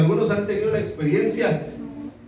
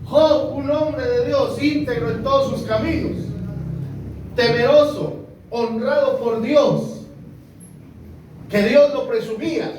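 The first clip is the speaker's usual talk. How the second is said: raised, ringing sermon voice on Job; preaching; 5 semitones higher than usual. Acted. Pastor exalts Job to the congregation, preaching. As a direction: preach it out with conviction.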